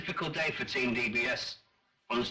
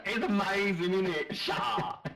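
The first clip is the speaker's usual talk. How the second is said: higher, camp put-on gushing; camp mimicry; 7 semitones higher than usual. Carrying on the teasing after being told it's irritating, camp mimicry. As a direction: push it up high and camp. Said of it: "sounds camp doing it".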